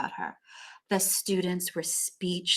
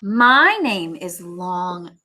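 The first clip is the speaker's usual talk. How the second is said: gentle teacher; 7 dB louder, much slower than usual. Acted. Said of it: "said Missus Twinkle".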